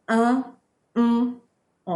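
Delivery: "un" and "en" said as nasal vowels through the nose; French nasal vowels. Shown as a demonstration. Teacher demonstrating nasal "un" and "en" for students. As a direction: model the French nasals clearly. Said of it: "these sounds are the sounds".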